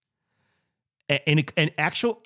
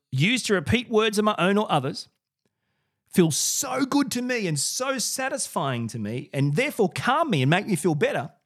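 ordinary delivery

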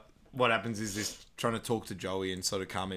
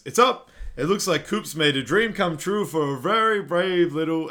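high, loud announcer over a bullhorn; stadium announcer; 6 semitones higher, 10 dB louder than usual. Retold or imitated. Announcer on the bullhorn calling Coop's home run, high and loud. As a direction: call the home run like a ballpark PA. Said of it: "you hear a bullhorn".

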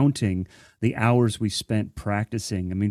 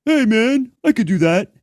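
much higher and louder mock bravado; mocking cocky amateur; 11 semitones higher, 7 dB louder than usual. Mocking the boss who thought he could play, higher and louder. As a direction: sneer it as the overconfident amateur. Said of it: "You came in and you thought".

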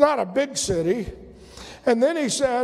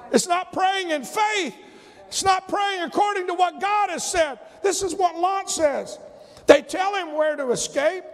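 ordinary talk

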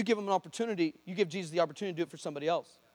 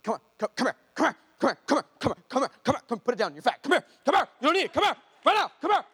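frantic repeated summons, climbing higher and louder; freaking out; 6 semitones higher, 7 dB louder, faster than usual. Retold or imitated. Shaken teacher calls colleagues in the lounge, imitated frantic shouting. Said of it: "And she's freaking out".

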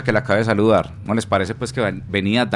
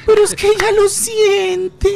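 high whiny wife voice, near tears; man doing a woman's voice; 8 dB louder, slower than usual. Retold or imitated. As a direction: wail the name like a tearful wife. (hombre imitando voz de mujer llorosa)

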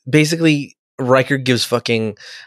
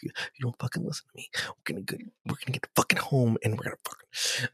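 angry hushed yell, fast; furious but kept to a whisper; 13 dB quieter, faster than usual. Retold or imitated. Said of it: "angrily, like, whisper yells".

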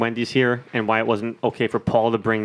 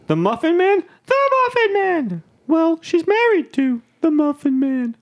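very high, slow squeaky cookie voice; Gingy impression; slower than usual. Host tries his Gingy voice on friends, squeaky and slow. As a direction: squeal each word like the little gingerbread man. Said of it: "Did that sound like Gingy a little bit?".

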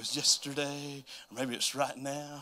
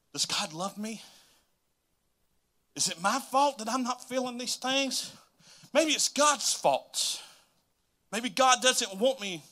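high-pitched, slowed questioning; lightly acted troubled doubter; doubting worshipper; 8 semitones higher, slower than usual. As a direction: wonder aloud in a higher voice.

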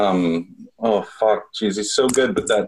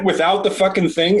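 ordinary talk, nothing marked

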